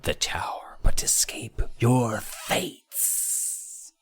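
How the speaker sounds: slow, drawn-out whisper; mysterious hushed reveal, stretching the end; dramatic whisper. Acted. Mock-dramatic quote of the sorcerer.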